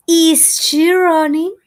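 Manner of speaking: rising demo question to students; question tune. Shown as a demonstration. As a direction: ask it like a model question. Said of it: "for the interrogative way".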